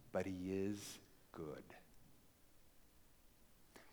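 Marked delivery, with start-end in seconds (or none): 0.1-1.6 soft, low, slow reassurance; gentle reply. A narrator's reading. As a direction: answer quietly and tenderly. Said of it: "then the reply was".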